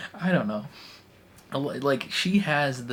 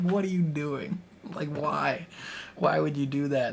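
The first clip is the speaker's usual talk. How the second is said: raised, mock disbelieving what-are-you-doing; half-laughing disbelief at a reckless move; incredulous; 4 semitones higher than usual. Fan mocking Vasquez's choice, raised incredulous voice.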